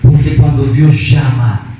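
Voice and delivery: low, weighty and deliberate; solemn, heartfelt testimony. Real, not acted. Preacher telling of being broken when God called, solemn and heartfelt. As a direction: drop low and let each phrase land heavily.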